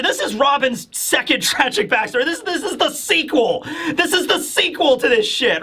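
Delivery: high-pitched, real laugh through the words; laughing disbelief. Real, not acted. Reviewer to audience about a second tragic backstory, laughing disbelief. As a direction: laugh incredulously while talking.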